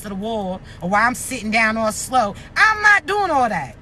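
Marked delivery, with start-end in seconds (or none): 2.6-3.8 shouting. Real, not acted. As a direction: belt out the refusal. Ranting refusal, shout heard.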